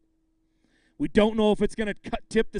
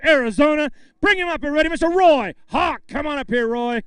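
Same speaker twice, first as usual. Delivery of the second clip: booming, higher, louder stage introduction; announcer call; 7 semitones higher, 6 dB louder than usual. Real, not acted. Emcee calling the angler up to the scale, booming. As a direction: call him up like a ring announcer.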